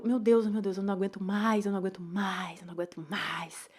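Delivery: despairing words fading into a whisper. Retold or imitated. To therapist, exhausted plea fading to a whisper. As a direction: let it sink from voiced to breath. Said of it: "aí eu".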